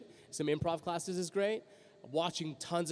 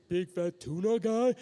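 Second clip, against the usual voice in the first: slow, drawn-out big guy voice; big fat tuna character; 3 semitones higher, slower than usual. Shown as a demonstration. Voice actor showing first cartoon part, big tuna voice. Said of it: "it was this guy's voice".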